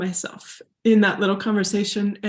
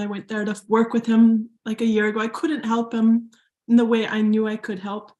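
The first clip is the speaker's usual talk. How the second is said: ordinary delivery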